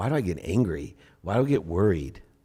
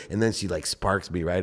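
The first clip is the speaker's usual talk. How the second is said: ordinary delivery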